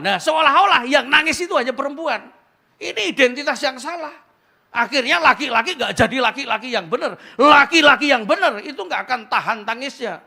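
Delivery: loud, high preaching voice; preaching at full force. Acted. Pastor drives the point home to the congregation, loud and high.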